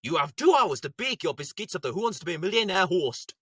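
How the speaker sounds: ordinary delivery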